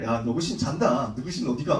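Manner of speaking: low-pitched, lightly acted jeer; retold taunt, pitched well down; mocking taunt. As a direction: tease the rivals in a low voice. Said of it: "개드립을 치는 거야".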